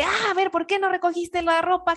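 mock scolding. Wife scolding husband about laundry, sharp and high. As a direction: nag him about the clothes. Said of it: "yo no le digo a mi esposo de".

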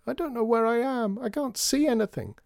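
put-on very high, small child's wail; crying out. A narrator's reading. Lost child telling father of the dark, crying out. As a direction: call helplessly from far off. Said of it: "cried another voice further away".

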